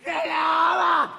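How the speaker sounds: slow, shouted thanks; drawn-out thanks shout. Real, not acted. Worshipper thanking God aloud, slow shouted thanks. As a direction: stretch the thanks into a cry.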